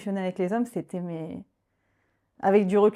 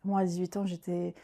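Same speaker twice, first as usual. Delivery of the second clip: ordinary delivery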